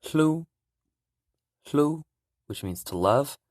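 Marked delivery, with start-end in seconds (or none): 0.0-2.0 "hlu" high and breathy, H before L; teacher pronouncing "hlu"; Hmong hlu. Shown as a demonstration. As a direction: sing out "hlu" as the word for love. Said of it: "which is pronounced".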